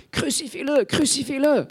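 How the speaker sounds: bien plus aigu, scandé comme une foule lointaine; foule qui scande. Retold or imitated. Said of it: "Elle entend la foule scander". (foule qui scande, voix bien plus aiguë)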